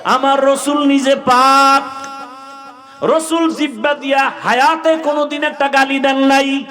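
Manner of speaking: high, loud chanting sermon delivery; full-force preaching. Real, not acted. Preacher rousing youth about the Prophet's example, high full-force chant. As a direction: preach it high, stretching the last words.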